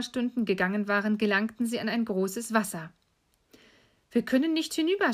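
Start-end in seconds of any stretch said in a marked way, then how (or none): 4.1-5.1 put-on high, quick boy's voice; worried young Hänsel. Hänsel stopped at the big water, high dismayed voice. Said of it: "sprach Hänsel".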